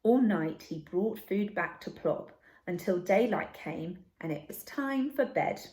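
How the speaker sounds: usual speaking voice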